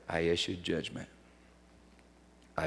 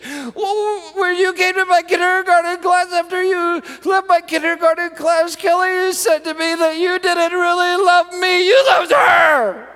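put-on sobbing little girl; 14 dB louder than usual. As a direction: wail it out like a heartbroken girl. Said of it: "through tears and through sobs".

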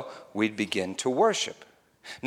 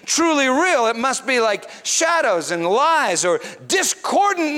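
louder and higher, preaching with rising fervor; preaching at full force; 9 semitones higher, 5 dB louder than usual. Acted. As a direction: proclaim it like a revival preacher, slowing on the big words.

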